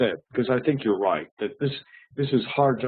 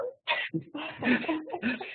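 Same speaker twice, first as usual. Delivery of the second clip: high laugh; 9 dB quieter than usual. Real, not acted. Laughing at own joke.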